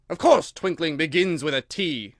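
loud, sharp correction. A narrator's reading. King corrects the rambling Hatter, sharp and loud. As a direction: put him down sharply. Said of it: "said the king sharply".

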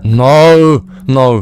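high, loud panicked shouts; scared shout. Real, not acted. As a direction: yell No in real fright. Horror game jump scare.

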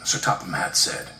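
plain reading voice

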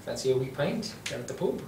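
light Irish lilt offering a pint; a lightly done Irish pub voice; mild Irish accent. Shown as a demonstration. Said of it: "go to the Irish".

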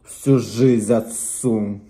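usual speaking voice

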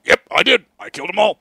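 loud, high ranting Alex Jones parody; a loud, high, frantic send-up of Alex Jones; mock Alex Jones. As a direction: rant it out like a deranged talk-show host. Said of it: "he's like".

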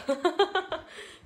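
高く大きな笑い; 自分で吹き出す; 笑い声. Real, not acted. 非常食でないと笑う. (高く弾けた笑い)

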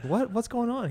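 high-pitched, baffled sitcom impression; imitated high-voiced comic bewilderment; Jerry Seinfeld. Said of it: "That's a good Jerry and Seinfeld impression".

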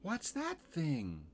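put-on high, slow little-girl question; Lucy. Girl puzzled by the laundry, high and slow. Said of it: "said Lucy".

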